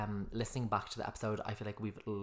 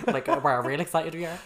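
sarcastic loud, higher laughing; mock excitement; 6 semitones higher, 11 dB louder than usual. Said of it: "We sound so monotone".

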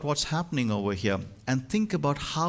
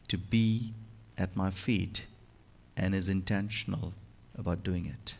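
gentle Jesus; 5 semitones lower, 6 dB quieter than usual. Acted. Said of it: "But Jesus says".

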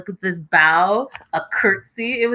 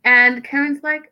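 ordinary talk, nothing marked

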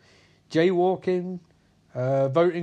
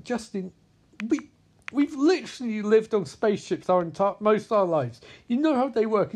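higher-pitched, retold appeal to reason; mock exasperated plea; 6 semitones higher than usual. Crewmate reasoning with Justin at the airlock, mock exasperated. Crew pleading with a possessed crewmate.

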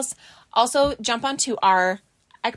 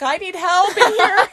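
mom calling out; 7 semitones higher, 6 dB louder, slower than usual. Retold or imitated. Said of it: "My mom's like".

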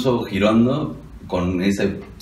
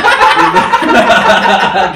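loud laugh; 10 semitones higher, 10 dB louder than usual. Real, not acted. Friends cracking up at joke.

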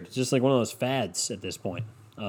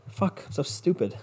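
muttered self-scolding; 7 semitones higher, 6 dB quieter than usual. Retold or imitated. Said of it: "scolding himself".

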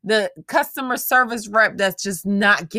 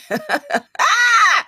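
high shrieking burst of laughter; loud whooping cackle; 12 semitones higher, 6 dB louder than usual. Real, not acted. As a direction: let out a loud screaming laugh.

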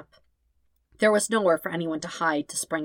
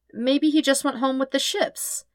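high, hopeful question; 9 semitones higher than usual. Acted. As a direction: reach for a good answer. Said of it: "Aang asked hopefully".

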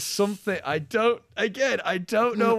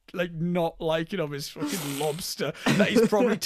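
ordinary delivery

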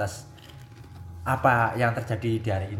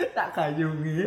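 laughing; 5 semitones higher, much slower than usual. Real, not acted. Laughing at bucket bath joke.

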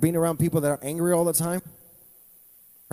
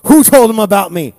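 loud joking "who told them about me"; preacher's mock outrage; 3 semitones higher, 12 dB louder than usual. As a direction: tease the quiet crowd, loudly. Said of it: "it got quiet up in here".

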